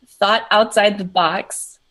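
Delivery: usual speaking voice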